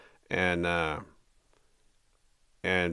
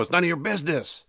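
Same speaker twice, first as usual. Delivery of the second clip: retold yell; 8 semitones higher than usual. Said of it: "I kept telling them".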